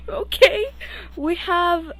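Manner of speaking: high, loud, just out of a giggling fit; laughter still in voice. Real, not acted. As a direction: push on reading through real giggles. Resumes reading after a laughing fit.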